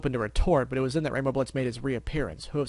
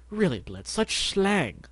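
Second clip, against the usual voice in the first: affronted; 7 semitones higher, much slower than usual. Acted. Elusive affronted by slang.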